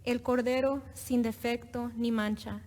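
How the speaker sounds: usual speaking voice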